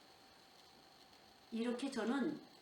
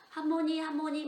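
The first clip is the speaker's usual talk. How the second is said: imitated toddler calling, pitched up; grandchild calling grandma; 6 semitones higher, 5 dB louder than usual. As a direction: call out for grandma like a small child. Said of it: "막 이러고 가요".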